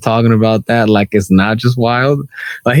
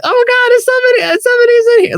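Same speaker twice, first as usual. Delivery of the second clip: much higher startled squeal; mock panicked lady caught in the stall; startled woman; much faster than usual.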